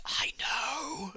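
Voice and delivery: breathy, voiceless whisper in the ear; imitating a whisper. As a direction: lean in and breathe it like a secret. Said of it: "leans in and whispers him and goes".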